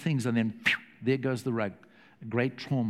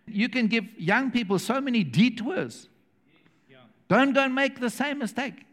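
normal talking voice

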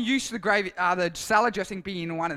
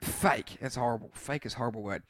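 disgusted fake; 8 semitones lower, 4 dB quieter than usual. Shown as a demonstration. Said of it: "Tell us, say fake".

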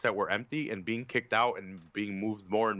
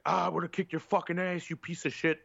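mock drunk yell; 6 semitones higher than usual. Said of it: "he's just yelling in nerdy dude's face".

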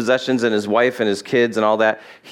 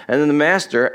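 ordinary delivery

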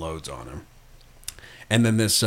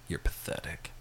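whispered, much softer contempt; disgusted whisper; 4 semitones lower, 13 dB quieter than usual. Retold or imitated. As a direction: breathe it out in disgust. Looks at Chepeska with disgust.

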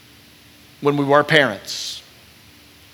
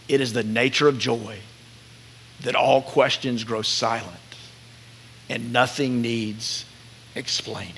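slow, soft quotation, fading to very quiet; hushed reverence; 2 semitones lower, 5 dB quieter than usual. Real, not acted. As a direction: read it tenderly and let it sink away. Reads a writer's line that moves him.

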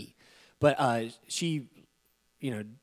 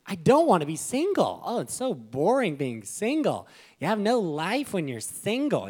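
higher, louder lament; whiny single guy; 6 semitones higher, 3 dB louder than usual. Retold or imitated. Pastor voicing his younger single self, whining. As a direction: whine about being single.

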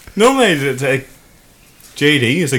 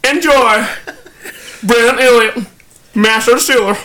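put-on Kentucky accent; 12 semitones higher than usual. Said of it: "You should be reading it in a Kentucky accent".

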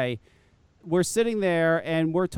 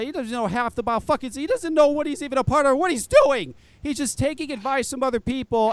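heated rant; 6 semitones higher, 3 dB louder than usual. Real, not acted. Ranting about Pete's critics.

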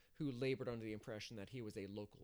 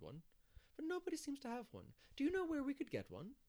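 higher-pitched, eager village busybody; busy organiser; 5 semitones higher than usual. Acted. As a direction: chatter on about the plans, voice lifting high. Narrator voices Mrs Lynde chatting.

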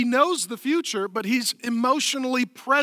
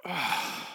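long, soft, low sigh from the soul; deep sigh; 4 semitones lower, 8 dB quieter than usual. Shown as a demonstration. Preacher demonstrating to the congregation, deep sigh. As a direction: let all the air out heavily. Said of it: "It's just a deep sigh from the soul".

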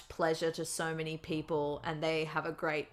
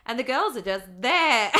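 laughing; 5 semitones higher, 8 dB louder than usual. Real, not acted. Joking retelling to housemate.

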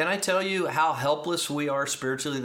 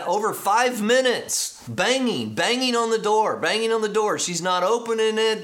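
higher, louder, urgent telling; intense retelling; 7 semitones higher, 3 dB louder than usual. Acted. Preacher building the tension of the locked plane door, urgent. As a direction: drive it home with urgency.

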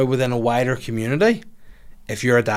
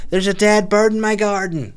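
much higher, odd mock neighbour voice; silly put-on voice; 10 semitones higher, 4 dB louder than usual. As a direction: play her as a squeaky caricature. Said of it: "that's not how she speaks".

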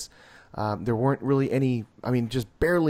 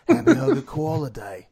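louder, hammed-up Australian accent; mock Australian accent; 2 semitones higher, 5 dB louder than usual. Repeating the trailer's joke for a friend, hammed-up Australian accent. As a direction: push the Australian vowels, amused. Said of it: "does it in this great accent".

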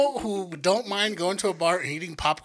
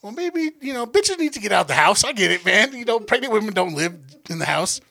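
sarcastic inner voice; 5 semitones higher, 5 dB louder than usual. Retold or imitated. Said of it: "I'm, like, thinking".

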